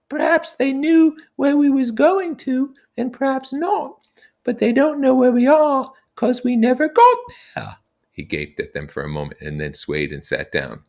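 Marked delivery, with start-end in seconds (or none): 0.1-7.8 Piggy scolding. Acted. Piggy telling the boys off for hindering Ralph, high and fussy. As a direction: nag them about what matters.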